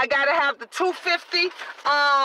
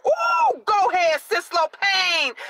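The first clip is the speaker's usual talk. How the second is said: excited cheer; 4 semitones higher, slower than usual. Real, not acted. Cheering a viewer's comment.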